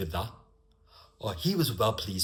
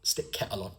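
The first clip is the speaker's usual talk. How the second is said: regional British accent, "the" dropped; understated regional dialect delivery; 3 semitones lower than usual. Shown as a demonstration. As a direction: put a local accent on "Stick kettle on". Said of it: "I also put an accent there".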